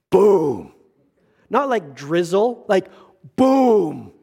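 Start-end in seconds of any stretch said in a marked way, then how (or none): 0.1-0.7 loud sudden boom for the rain. Retold or imitated. Pastor describes rain hitting the wedding, loud boom. 3.3-4.1 loud sudden boom for the rain. Retold or imitated. Pastor describes rain hitting the wedding, loud boom.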